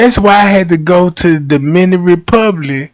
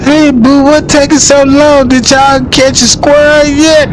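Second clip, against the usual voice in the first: calling out wife; 6 semitones higher than usual. Pretend. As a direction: call out to the woods, eager. Wife calls out to hunter.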